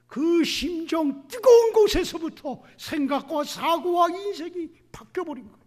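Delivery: impassioned preaching. Real, not acted. Preacher's passionate climax.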